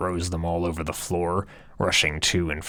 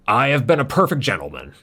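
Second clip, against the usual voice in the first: higher, louder, fast prim protest; smug self-defense; 6 semitones higher, 4 dB louder, faster than usual. Acted. Chad to Earl, fast indignant self-defense. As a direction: rattle it off indignantly.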